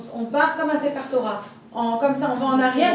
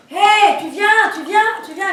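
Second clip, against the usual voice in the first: loud impatient call up the stairs; high, loud impatient husband calling; 7 semitones higher, 9 dB louder, slower than usual. Retold or imitated. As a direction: yell up to hurry her.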